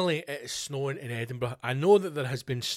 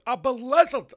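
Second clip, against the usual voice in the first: high, loud burst on BLIZZARD; mock-dramatic exclamation; 11 semitones higher, 9 dB louder, slower than usual. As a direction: blurt it out with overblown drama. Mock-dramatic repeat of the term.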